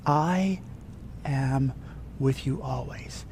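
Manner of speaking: hushed, slow, tender promise; soft whisper of God. Acted. Pastor voicing God softly to the congregation, hushed. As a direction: let it fall to a hush. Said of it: "he whispers into your ear".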